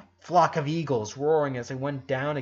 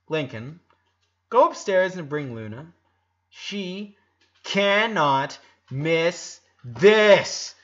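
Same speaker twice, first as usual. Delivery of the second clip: louder, slow and commanding; bossy order; 4 semitones higher, 8 dB louder, slower than usual. A narrator's reading. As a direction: boss him around. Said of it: "ordered Laurie".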